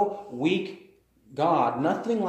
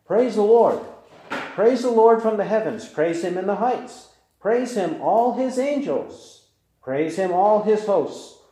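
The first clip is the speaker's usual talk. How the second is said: higher, louder proclamation of praise; full-voiced preacher reading a psalm; exultant psalm reading; 5 semitones higher, 5 dB louder than usual. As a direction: call out each praise to the rafters. Reads psalm with full force.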